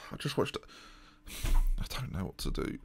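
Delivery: soft, breath-heavy resignation; defeated sigh. Real, not acted. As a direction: sigh it out, utterly at a loss. Despairing after conceding goal.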